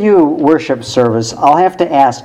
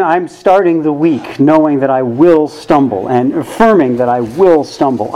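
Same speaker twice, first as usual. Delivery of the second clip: ordinary talk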